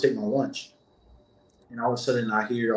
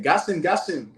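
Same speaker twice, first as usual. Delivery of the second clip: imitating a coworker's light African accent, pitched higher; 6 semitones higher than usual. Officer behind him urging gas, light imitated African accent. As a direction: urge it in a mock African accent. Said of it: "in his little accent".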